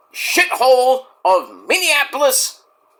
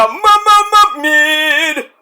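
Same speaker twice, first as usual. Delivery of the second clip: high, stretched stutter on the opening m; mocking stuttered verdict; 7 semitones higher, 5 dB louder than usual. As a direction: stammer it slowly for comic effect.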